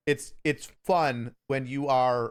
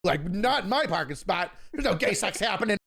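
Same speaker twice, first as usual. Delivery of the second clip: higher-pitched imitated outrage; mock indignant neighbor; 7 semitones higher than usual. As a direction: lightly play a scandalized parking-spot owner. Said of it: "He's like".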